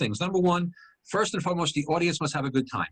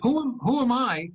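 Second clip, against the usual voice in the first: higher, slowed rhetorical question; self-questioning; 9 semitones higher than usual. Retold or imitated. Comic checks his own judging of a stranger, self-questioning. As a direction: pull yourself up with a raised, drawn-out question. Turns the judgement on himself.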